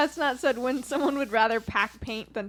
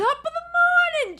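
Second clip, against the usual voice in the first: loud, very high mock salutation; imitated greeting; 6 dB louder than usual. Coworker imitating yesterday's greeting to the boss, exaggerated. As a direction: sing out a hammed-up morning hello. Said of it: "yesterday that you greeted him".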